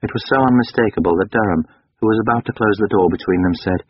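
ordinary delivery, nothing marked

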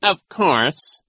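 loud, firm character voice; suddenly serious. A narrator's reading. Genghis abruptly stops laughing, serious tone. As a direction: turn serious after laughing. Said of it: "tone of voice that was suddenly serious".

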